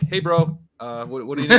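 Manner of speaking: laughing mock local. Mexican clerk answering the tourist in English, laughing. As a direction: play the clerk switching to English, amused. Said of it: "And they're like".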